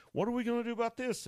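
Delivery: preacher imagines pestering Jesus face to face; eager mock question. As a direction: rattle the question off high and quick. Imagined asking Jesus in person.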